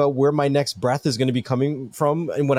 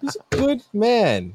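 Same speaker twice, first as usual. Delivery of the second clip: mock eulogy; 8 semitones higher, slower than usual. Mock eulogy repeat, slow.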